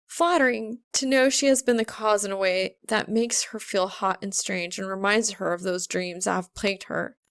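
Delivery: ordinary delivery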